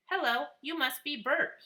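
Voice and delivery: a child playing a TV puppet; Sesame Street voice. A narrator's reading. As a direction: toddler doing a Sesame Street character. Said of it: "in his best Sesame Street voice".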